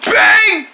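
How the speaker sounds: sharp high yelled bang; shouted bang effect. Acted. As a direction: yell bang like a gunshot.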